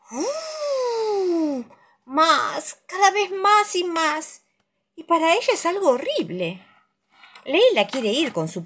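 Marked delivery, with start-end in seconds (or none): 0.1-6.7 high wailing ooh and slow rise; howling wind voice. A narrator's reading. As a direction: howl it like the wind.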